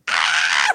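mock scream, pitched low; a quick staged scream; retold scream. Recalling her fright at the party, mock scream. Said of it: "I'm screaming".

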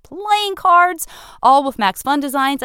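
a hint of a cartoon character selling the prize; a touch of a cartoon announcer. Real, not acted. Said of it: "I started sounding like a cartoon voice".

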